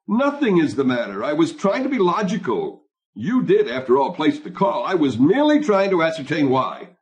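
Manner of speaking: high, indignant self-justification; defensive protest. A narrator's reading. Husband justifies himself to his wife, defensive and high.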